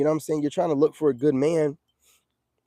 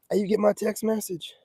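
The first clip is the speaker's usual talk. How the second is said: normal talking voice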